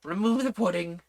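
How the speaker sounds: a hint of a sulky queen, slow; mildly sulky royal order; sulky growl. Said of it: "looked rather sulky and growled".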